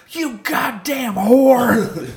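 high, slow mock shriek; imitating a furious neighbor; angry yell. Neighbor yelling at tree.

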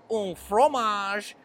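slow, hammed-up French; fake French accent. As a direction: drawl it like a phony Frenchman. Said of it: "And they'll be like".

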